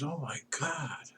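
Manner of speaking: a hushed, horrified gasp of disbelief; a mild, breathy shock. Acted. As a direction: recoil at a dangerous mistake. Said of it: "the pundit was horrified".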